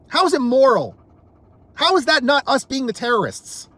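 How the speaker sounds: ordinary delivery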